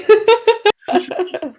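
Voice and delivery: high, loud burst of laughter; real high-pitched laugh. Real, not acted. Reacting to an intense scene.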